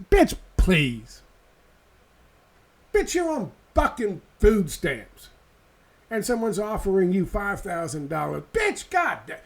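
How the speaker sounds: sassy friend voice. Acted. Mocking friends' reply in sassy voice.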